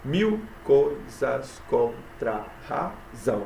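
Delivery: "Mil coisas contra a razão" very slow, broken into syllables. Shown as a demonstration. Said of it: "sete sílabas". ("Mil coisas contra a razão" escandido bem devagar)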